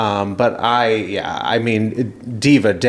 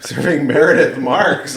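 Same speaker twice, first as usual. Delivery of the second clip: higher-pitched, loosely slurred words; Meredith Marks slur; 5 semitones higher than usual. Real, not acted. Host teasing own tangled tongue, slurring. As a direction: let the words slide together. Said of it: "I'm, like, slurring".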